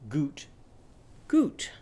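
owl hoot. Shown as a demonstration. Teacher answering as Gilda Goose's owl, two-note owl hoot. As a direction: hoot twice, jumping up on the second. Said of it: "Gilda Goose's owl say?".

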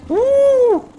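high, frantic, rapid outburst; panicked squealing. Real, not acted. Player finding the water too shallow, panicked squealing. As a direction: squeal and hoot in alarm.

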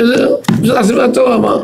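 high-pitched, imitating an overexcited rabbi; retold excitement. Rabbi telling his student why he shouted, retold excitement. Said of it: "מרוב שהתרגש הוא צעק".

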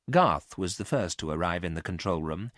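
ordinary delivery

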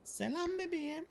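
high falsetto woman voice; man doing a woman's voice. Pretend. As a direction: coo it like a flirty woman. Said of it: "o ses ne".

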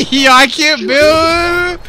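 high, loud, drawn-out yell; stretched-out gamer outburst; frustrated wail. Real, not acted. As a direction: wail about the game in slow, stretched words. Outburst over failing game controls.

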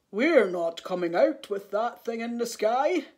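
loud, put-on elephant voice; refusing elephants. A narrator's reading. Elephants in the cave refuse Elmer, firmly. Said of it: "they said".

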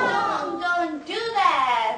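slow, high pleading chant; drawn-out mock alarm; bird in crisis. Shown as a demonstration. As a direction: plead like a little bird in danger. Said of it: "얘는 이제 위기에 처한 새".

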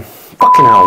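loud, high curse at himself; exasperated outburst. Real, not acted. Vlogger loses his words, loud exasperated curse. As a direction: snap out a curse at your own fumble.

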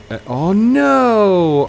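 alarmed. Real, not acted. Car slips again.

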